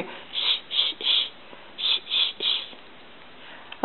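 put-on whispered shh like a mommy hushing a baby; breathy shushing whisper. Grandma shows the mommy on the bus, whispering shh. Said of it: "Where's your finger go?".

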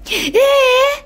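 squeaky high pet talk. Real, not acted. Cooing to the baby birds.

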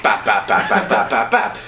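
laughing bap bap gunfire noises; mimicked gunfire. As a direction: rattle off the shots. Imitating machine gun fire.

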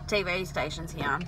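ordinary delivery

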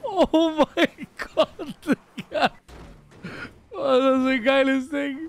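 thrilled. Real, not acted. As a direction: draw out the wow at the outfit. Thrilled by the new outfit.

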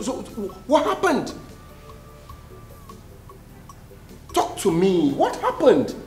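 high, urgent alarmed questions; alarmed brother demanding what happened. Acted. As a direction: panic at the news.

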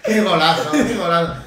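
loud laughing goal cry; breakneck goal commentary. Real, not acted. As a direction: shout the goal while laughing.

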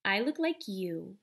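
plain reading voice

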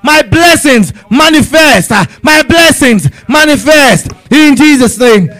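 rising, urgent prayer, pressing each line; fervent prayer. Acted. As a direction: pray it out with full force to God. Fervent prayer leading congregation.

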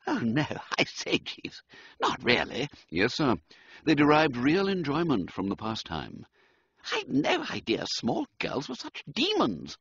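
high, laughing disbelief; horrified gentleman, half laughing; upper-class young man appalled; aghast toff. Acted. Wooster aghast at girls' game.

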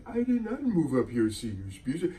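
soft, meek Norbit. Retold or imitated. A hint of timid Norbit answering his wife. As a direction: murmur a timid protest. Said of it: "Norbit was like".

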